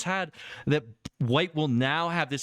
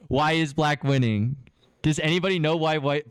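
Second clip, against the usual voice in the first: ordinary talk, nothing marked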